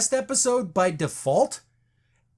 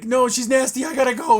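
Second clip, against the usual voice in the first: mock panic; 5 semitones higher, 5 dB louder than usual. Brad panicking, retold.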